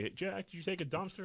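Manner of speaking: high, sing-song mock old lady asking again; chirpy old lady. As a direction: repeat the chirpy old-visitor question. Old lady visiting each day.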